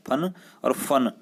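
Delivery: slow, careful contrast of फन and फन; contrast demo. Shown as a demonstration. Teacher to students, slowly contrasting फन and फन. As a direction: stretch out फन and फन so the nukta sound stands out. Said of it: "दोनों में अंतर है".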